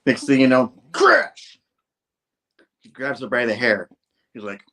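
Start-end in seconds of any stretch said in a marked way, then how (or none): 0.9-1.6 high, explosive exclamation; loud sound effect. Acted. Reader voicing the smash, high loud exclamation. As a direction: burst it out like a comic caption.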